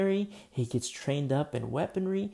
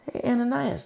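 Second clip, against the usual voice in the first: the Lord calling; 11 semitones higher, 5 dB louder than usual. Acted.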